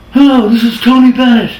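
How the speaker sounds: high, loud crooner voice on the phone. Retold or imitated. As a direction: ham up the famous old singer calling in. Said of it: "That's how I talk, kinda".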